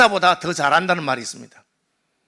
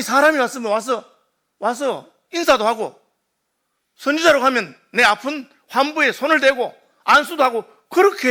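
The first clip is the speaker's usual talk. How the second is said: raised, higher, indignant outburst; angry general; 5 semitones higher, 3 dB louder than usual. Retold or imitated. Naaman fuming at the prophet's servant, angry and raised. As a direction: bristle at being snubbed, voice climbing. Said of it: "그러니까 화가 났어요".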